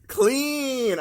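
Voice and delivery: loud, high cry of "Clean!"; triumphant shout. Pretend. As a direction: yell "Clean!" in the made-up language. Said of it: "I can speak Mister Curtis's language".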